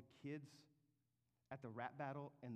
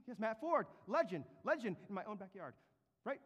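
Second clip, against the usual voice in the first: boastful Haman; 7 semitones higher, 8 dB louder than usual. Acted. Preacher voices boastful Haman.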